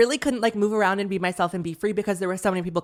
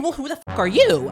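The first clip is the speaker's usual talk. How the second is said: higher, louder mock confrontation; indignant comeback; 7 semitones higher, 5 dB louder than usual. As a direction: throw it back at a rude stranger. Acted comeback to rude stranger.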